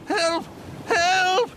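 desperate high shrieks for help; panicked frantic pleading. A narrator's reading. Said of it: "shrieked the man, now in desperate fear".

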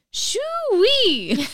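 high, loud, gleeful exclamation; kid's shoo-wee. Retold or imitated. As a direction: crow it like a proud toddler. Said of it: "instead he said".

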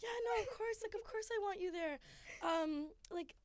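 high-pitched, put-on agreeableness; overeager reassurance. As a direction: reassure them in a strained high voice. Acted reassurance to ex at breakup.

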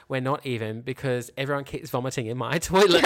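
words bubbling with real laughter; laughing. Real, not acted. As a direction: quote him while laughing through it. Laughing while quoting husband.

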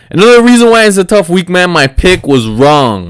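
loud, high burst easing as it goes; real frustrated yell, tapering off. Real, not acted. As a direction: vent loudly about a wrong pick. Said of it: "I don't know if I was yelling".

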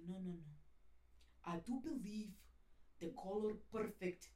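put-on slow Russian accent, chunk by chunk; stern-sounding Russian accent. Russian framer advises on frame color, stern imitated Russian accent. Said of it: "eles falam, "Parece que tá brabo".

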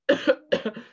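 sharp real cough; coughing fit. Real, not acted. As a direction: cough to clear the throat. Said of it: "Do excuse me".

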